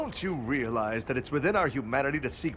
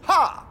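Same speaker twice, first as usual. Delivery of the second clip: sharp high ha; triumphant ha; 13 semitones higher, 6 dB louder than usual. Acted. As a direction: bark a triumphant laugh. Hero laughs triumphantly.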